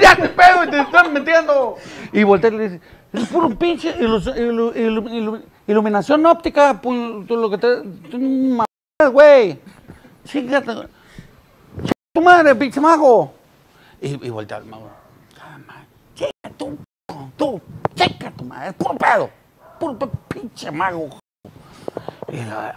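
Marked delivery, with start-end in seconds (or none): 0.0-13.3 much higher, louder slurred rant; drunk yelling. Retold or imitated. Drunk yells at the magician about optical illusions, high and loud. 15.4-21.1 higher slurred curses; drunk grumbling. Retold or imitated. Drunk keeps cursing the magician, higher pitched.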